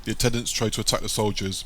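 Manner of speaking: ordinary delivery, nothing marked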